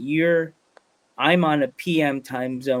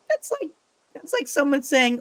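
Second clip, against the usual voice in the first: high exasperated; 11 semitones higher than usual. Retold or imitated. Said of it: "I'm like".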